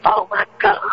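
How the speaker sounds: high, shocked squeal; hammed-up astonishment; mock disbelief. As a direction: play it as over-the-top disbelief. Mock shocked reaction to news.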